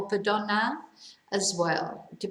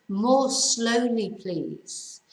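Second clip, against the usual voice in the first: slow model phrase; much slower than usual. Shown as a demonstration. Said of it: "teacher models the English slowly".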